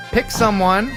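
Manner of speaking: normal talking voice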